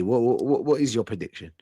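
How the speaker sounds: normal talking voice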